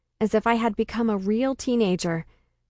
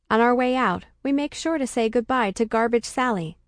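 ordinary delivery, nothing marked

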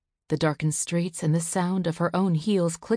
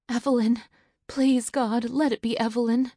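high, louder desperate prayer; desperate plea; 6 semitones higher, 3 dB louder than usual. Acted. Mother hearing the door and praying it's her daughter, high desperate plea. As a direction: call her name and beg heaven.

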